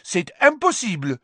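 high, loud outburst in French; indignant French headmistress. Acted. Madame Maxime objecting to the judges' decision, indignant. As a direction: exclaim it in high-pitched protest.

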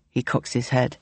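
plain reading voice

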